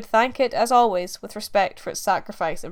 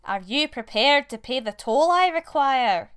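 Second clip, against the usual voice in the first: slow, higher witch voice; 5 semitones higher than usual. A narrator's reading. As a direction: drawl it like a forest witch. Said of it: "asked the witch".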